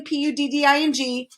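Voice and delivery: each letter of PUDDING said separately; spelled out letter by letter. A narrator's reading. Spelling bee spells out his word, letter by letter. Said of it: "said the spelling bee".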